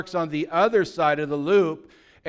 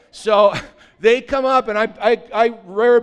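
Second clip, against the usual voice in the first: laughing; 7 semitones higher, 10 dB louder than usual. Real, not acted. Laughing while retelling fight.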